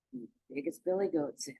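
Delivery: ordinary delivery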